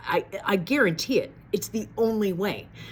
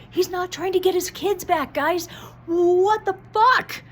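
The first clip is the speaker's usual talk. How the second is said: building from high and loud to a slow, much higher, louder outcry; real upset outburst; 13 semitones higher, 6 dB louder than usual. Real, not acted. Telling viewers he won't get his kids back, outraged. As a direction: let the frustration boil over to the audience. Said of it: "I'm very upset".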